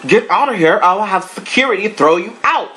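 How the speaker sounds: high, loud mock shout of the same threat; mock furious comeback. Paris shouting the boyfriend's threat back at him, mock fury. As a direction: throw his threat right back at him. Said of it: "she was looking like".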